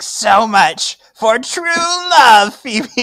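high, loud hammed-up sea witch gloat; Ursula villain voice. Villain Valen taunting Phoebe, hammed-up high Ursula-style gloat. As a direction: camp it up like a cartoon sea witch. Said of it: "Ursula moment".

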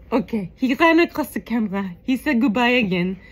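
ordinary talk

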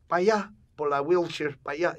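high, loud imitation of his father; emphatic mock-dad Spanish; dad speaking Spanish. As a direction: call out vague directions as dad. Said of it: "I told my dad in Spanish".

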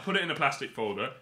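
ordinary talk, nothing marked

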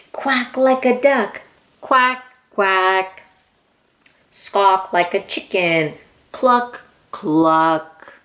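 ordinary delivery, nothing marked

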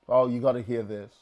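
ordinary talk, nothing marked

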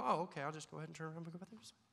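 casual shrug. Pretend. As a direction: toss it off quickly, no big deal. Casually accepting God's correction.

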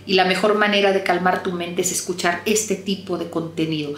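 ordinary delivery

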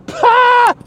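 sudden high shriek of a laugh; real high-pitched laugh. Real, not acted. Laughs at own joke.

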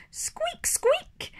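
high whispered squeak; little rabbit squeaking; tiny rabbit squeak. Acted. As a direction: squeak it breathily like a baby bunny. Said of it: "says Richard".

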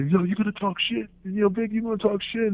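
voicing the wrestler confronting Vic; mock angry confrontation. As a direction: call him out. And I hear.

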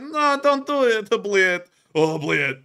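loud, drawn-out mock begging, high then falling; pleading soldier. Soldier caught by the drone, loud hammed-up pleading. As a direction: wail comically for mercy. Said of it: "He's like".

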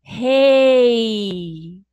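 "rei" said loud in one single mouth opening; one-syllable demo. Shown as a demonstration. Teacher showing children that rei is one syllable, loud. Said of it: "eu abro a boquinha só uma vez pra me falar rei".

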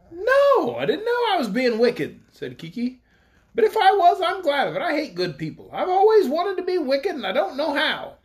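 a cocky youth boasting he wants evil; defiant boy Kiki. A narrator's reading.

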